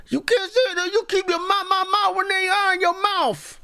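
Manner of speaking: very high-pitched mocking of an angry candidate; mock flustered rage. Host mocking an angry candidate's outburst, flustered high-pitched squeal. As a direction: squeal it in exaggerated, flustered outrage. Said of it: "See how flustered he is".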